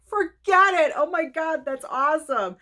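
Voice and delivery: high, loud amazed delight; thrilled outburst. Real, not acted. Reacting to Adam Sandler's nomination, thrilled. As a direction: burst out overjoyed.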